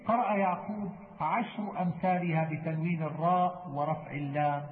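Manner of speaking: ordinary talk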